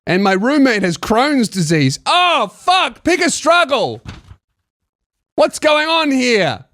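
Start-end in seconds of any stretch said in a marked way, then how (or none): 2.1-6.6 high-pitched comic outrage; mock exasperation. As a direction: cry out in play disbelief at yet another ailment. Mock outrage at list of ailments.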